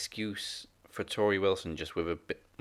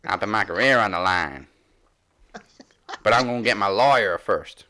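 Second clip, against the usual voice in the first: much louder mock Southern accent; Jazz's southern accent; 11 dB louder than usual. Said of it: "That was a little southern accent".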